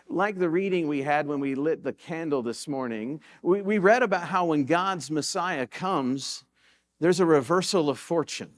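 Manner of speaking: normal talking voice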